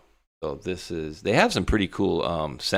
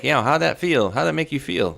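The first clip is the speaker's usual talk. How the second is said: higher and louder, taunting mock question; mocking needle; 9 semitones higher, 4 dB louder than usual. Teasing Will about the interview, a pitched-up mocking jab. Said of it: "I'm like".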